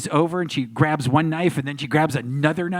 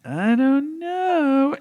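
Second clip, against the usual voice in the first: high, long-drawn squeal; mock squeal, stretched right out; imitating co-star; 11 semitones higher, much slower than usual. Co-star turning round at the premiere, imitated high squeal. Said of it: "She's like".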